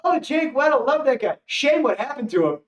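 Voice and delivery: high, fond voice of friends; lightly mocking, fond praise; mock gushing friends. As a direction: gush about him like old fans. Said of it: "they'd be like".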